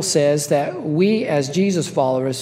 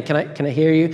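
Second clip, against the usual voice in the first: ordinary delivery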